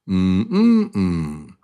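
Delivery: three hums, the second on top; humming the stress pattern. Shown as a demonstration. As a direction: middle hum leaps up. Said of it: "So syllable one is mm".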